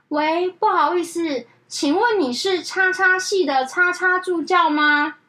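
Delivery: slow, drawn-out polite caller; slow caller mimic. Office worker mocking a slow phone caller, drawn out. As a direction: drag it out like the dawdling caller.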